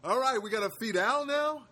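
high, loud put-on voice of the fed-up neighbor; mock exasperated; hammed-up grumbling neighbor asking about feeding Al; annoyed neighbor. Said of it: "Something worse than".

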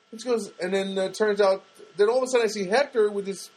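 normal talking voice

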